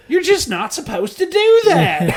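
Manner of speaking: squealing through laughter; high-pitched giggling line; laughing high voice. Pretend.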